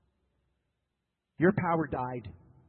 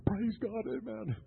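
mock churchgoer; 5 semitones higher, 3 dB quieter, slower than usual. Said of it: "Some people are like".

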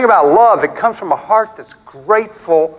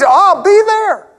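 high and loud, eager; eager promise; 13 semitones higher, 4 dB louder than usual. Retold or imitated. New convert told of evening service, eager high reply. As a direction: jump at the invite. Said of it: "The body's".

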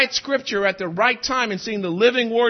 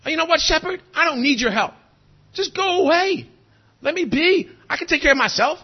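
a higher, lightly voiced stubborn sheep brushing off help; defiant sheep; 6 semitones higher than usual. Preacher voicing a sheep telling the shepherd to go away, higher and defiant. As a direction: toss it off like a proud sheep who wants no help. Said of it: "Imagine if the sheep was like".

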